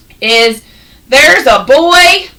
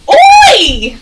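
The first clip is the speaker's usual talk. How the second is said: high, loud shouted Oi; 13 semitones higher, 5 dB louder than usual. Shown as a demonstration. Teacher leads class leaping, shouting Oi. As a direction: belt it out mid-leap. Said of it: "Let's all leap together".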